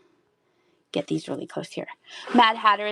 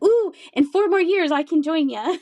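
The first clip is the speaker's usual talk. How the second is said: high, louder mock-eager quote; teasing excitement; 8 semitones higher, 5 dB louder than usual. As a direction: tease about joining the seniors' menu. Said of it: "I was like".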